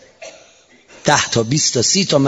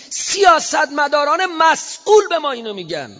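fiery preaching. Acted. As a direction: cry it out to the crowd at full force. (موعظهٔ پرشور با صدای بلند و زیر)

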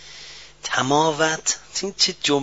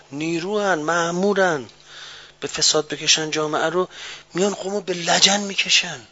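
normal talking voice

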